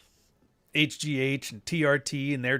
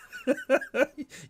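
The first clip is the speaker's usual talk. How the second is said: high laugh. Retold or imitated. Imita la risa del amigo.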